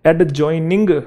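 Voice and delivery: "एडजॉइनिंग" with the D sounded out; D pronounced. Shown as a demonstration. Teacher showing a common mistake, "एडजॉइनिंग" with audible D. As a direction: put a separate D before J in "एडजॉइनिंग". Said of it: "एडजॉइनिंग नहीं है वो".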